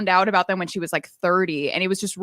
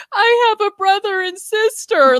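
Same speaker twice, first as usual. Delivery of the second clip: high, loud imitated happy wail; retold tearful cry; 13 semitones higher, 5 dB louder than usual. Girlfriend tells coworker of found siblings, imitated crying. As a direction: mimic crying out the big news. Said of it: "like that, and just crying".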